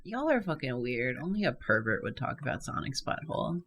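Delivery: low, soft deadpan jab; deadpan mutter. Acted. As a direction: say it quietly, dry and unimpressed.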